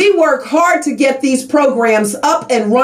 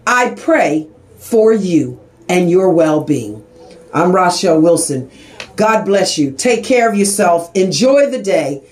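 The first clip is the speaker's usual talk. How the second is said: tender blessing; 7 semitones lower than usual. Real, not acted. Host blessing her listeners at the end, tender and low. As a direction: bless them gently as you say goodbye.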